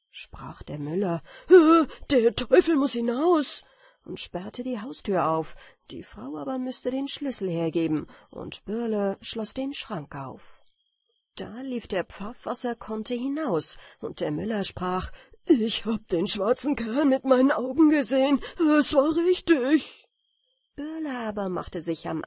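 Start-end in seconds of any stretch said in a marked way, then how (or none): 1.5-3.6 loud, high storybook cry; alarmed outcry. A narrator's reading. Miller learns the devil is in his cupboard, crying out loudly. 15.5-20.1 loud, high-pitched insistence; agitated exclamation. A narrator's reading. Miller astonished at fleeing devil.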